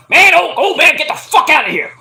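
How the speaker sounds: loud, high annoyed yell; mock angry brush-off. Archer brushing Jake off with a mock angry yell. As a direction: shove him off with your voice. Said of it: "Archer's like".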